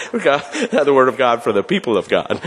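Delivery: real laugh through the words. Real, not acted. Reader recovers a forgotten response before the congregation, laughing. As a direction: recover the line while laughing.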